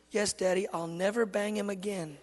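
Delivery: softly voiced contrite toddler, imitated; small, soft, obedient kid promise; cute little boy. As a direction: promise Daddy sweetly, quietly.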